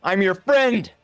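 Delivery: hammed-up yell for mercy; mock shout. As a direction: call out high, begging an attacker to stop. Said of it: "I just, I just shout".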